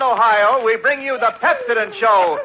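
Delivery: ordinary talk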